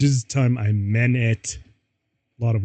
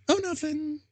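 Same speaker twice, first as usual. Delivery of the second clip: very high, softer sugary voice; sweetly; 7 dB quieter than usual. A narrator's reading. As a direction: play innocent in a sugary falsetto. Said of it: "I said sweetly".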